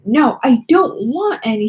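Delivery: loud, slow teacher yell. A narrator's reading. Teacher yelling at Nikki in his office, loud and slow. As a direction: snap it out at the intruding student. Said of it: "he yelled".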